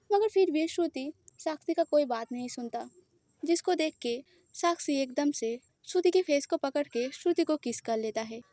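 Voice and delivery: plain reading voice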